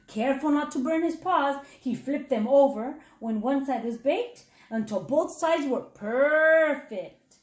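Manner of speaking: plain reading voice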